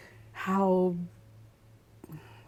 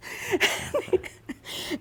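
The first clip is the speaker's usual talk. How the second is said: breathy laugh; 12 semitones higher, 6 dB quieter than usual. Real, not acted. After the punchline.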